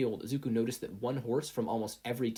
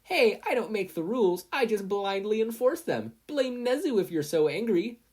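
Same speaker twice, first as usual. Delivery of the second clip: higher, loud brash retort; 11 semitones higher, 7 dB louder than usual. A narrator's reading. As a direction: shrug off the booing crowd. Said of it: "she retorted".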